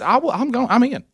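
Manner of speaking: hammed-up brave volunteer; mock heroic resolve. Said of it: "I said".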